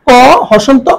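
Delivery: ordinary delivery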